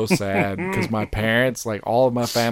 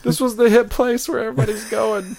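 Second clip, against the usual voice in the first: higher, laughing flustered excuse; 12 semitones higher, 3 dB louder than usual. Retold or imitated. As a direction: defend the pick while laughing. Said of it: "I was like, she's".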